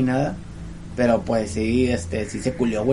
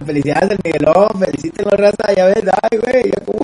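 mock excited cheer; 6 semitones higher, 6 dB louder than usual. Real, not acted. Teasing congratulations to the cohost.

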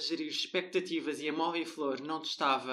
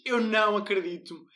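louder, hammed-up cry of disbelief; mock disbelief; 6 semitones higher, 7 dB louder than usual. Friend mocking Fábio unboxing the broken chair, hammed-up disbelief. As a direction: wail it like a cheated customer. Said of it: "Fábio desembala e só diz".

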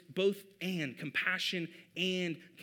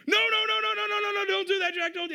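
put-on high, loud frantic "no no no" at a toddler; panicked yell; 7 dB louder than usual. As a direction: shout in sudden fear to stop the child. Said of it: "I yelled at him in that moment".

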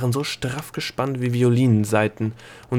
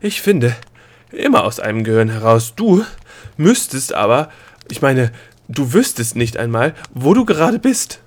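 breathless scolding; 2 semitones higher, 6 dB louder than usual. Acted.

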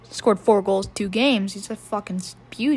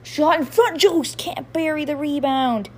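excited call; 5 semitones higher than usual. Real, not acted. Fan calling a near goal, excited. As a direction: jump up for the chance. Missed rebound, sudden excitement.

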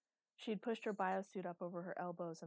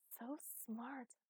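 whispering; 5 semitones higher than usual. A narrator's reading. Teacher praising child softly.

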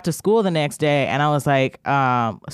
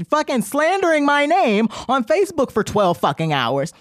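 ordinary talk

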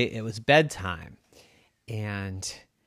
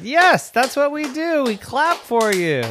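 high, drawn-out eager demand; insistent child; 12 semitones higher, 4 dB louder than usual. A narrator's reading. Child tells family they must clap, insistent. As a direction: sing out the answer with great insistence.

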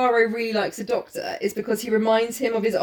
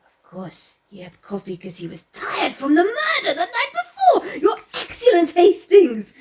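high, hammed-up fussy detective; Poirot impression; 7 semitones higher than usual. Poirot praising Hastings, hammed up high. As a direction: gush at Hastings, pitched up high.

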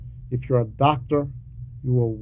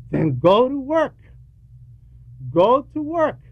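emphatic exhortation; 10 semitones higher than usual. Real, not acted. Preacher urging students to work.

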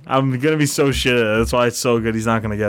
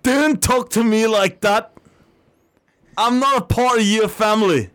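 hammed-up British accent, higher; mock British accent; 7 semitones higher than usual. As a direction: scold in an overdone British accent. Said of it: "I sound like a British fucking idiot now".

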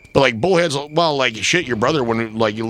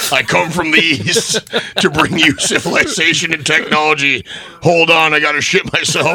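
loud, high, laughing pompous colonist; hammy explorer voice; 7 semitones higher, 7 dB louder than usual. Pretend. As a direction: ham up a grand explorer, cracking up.

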